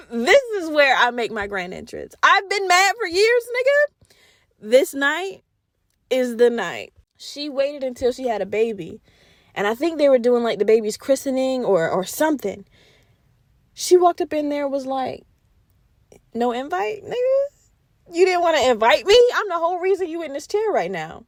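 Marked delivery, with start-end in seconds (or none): 2.2-4.1 grand-entrance taunt. Retold or imitated. Maleficent announcing her vengeful entrance, sassy and high. 16.3-21.2 mock-offended, jump high on the insult; indignant taunt. Uninvited Maleficent confronting the king, mock indignant. Crashing the christening.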